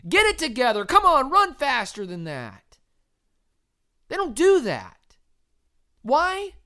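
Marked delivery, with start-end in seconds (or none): 0.1-2.8 raised, higher, exasperated scolding; mock nag at a struggling runner; higher and louder hectoring; imitated scolding. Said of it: "aren't yelling at them".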